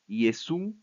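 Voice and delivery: "Jesús" as Greek Iesoun, ou sounded "oo"; diphthong OU. Shown as a demonstration. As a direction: say "Jesús" slowly as ye-SOON, long oo. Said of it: "con este diptongo ou".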